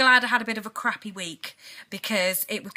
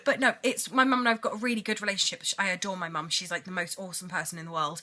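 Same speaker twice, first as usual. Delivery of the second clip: ordinary delivery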